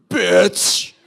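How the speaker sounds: loud, contemptuous single word; explosive mock-hostile jab; spat insult. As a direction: imitate the angry driver spitting out an insult. Driver's insult acted out, spat.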